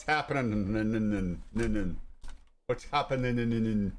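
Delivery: slow, stretched-out happening; drawn-out greeting. Real, not acted. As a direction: drag it out lazily.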